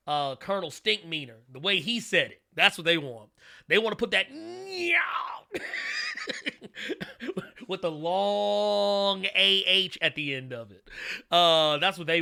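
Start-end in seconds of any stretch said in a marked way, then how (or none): 4.3-7.6 big high whooping laugh; shouted laugh. Real, not acted. Laughing at Stinkmeaner reference.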